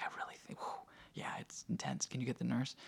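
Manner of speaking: very soft, mostly whispered imitation; retold whisper. Wife asking husband to get the nurse, whispering. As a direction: imitate a hushed plea through labor pains.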